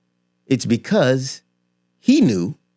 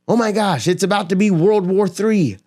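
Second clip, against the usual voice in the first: higher, rushed alarm; mock panic; 7 semitones higher than usual. Investor watching the news, hammed-up rising alarm. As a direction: spiral into hammed-up panic about war.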